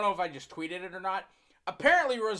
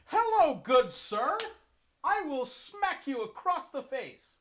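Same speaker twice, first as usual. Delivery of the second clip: mock polite gentleman; 7 semitones higher than usual.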